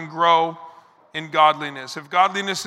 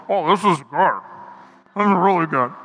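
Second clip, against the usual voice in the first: savoring with food in mouth; mouth full. Pretend. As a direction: talk through a tasty bite. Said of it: "Talking through the bite".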